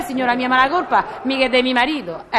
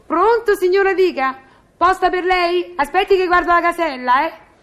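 higher, put-on obliging concierge voice; intercom answering voice; 6 semitones higher than usual. Concierge answers a tenant asking for post, intercom answering voice.

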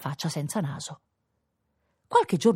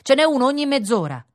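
loud, raised-pitch shout; sailor calling out; 9 semitones higher, 5 dB louder than usual. A narrator's reading. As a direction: call across the water to him. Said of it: "gridò un marinaio a quel signore". (richiamo gridato da marinaio)

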